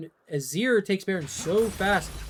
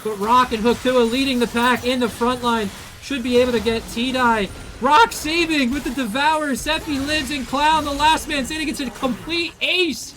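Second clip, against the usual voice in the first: excited play-by-play; 5 semitones higher, 4 dB louder than usual. Real, not acted. Teamfight climax in casting.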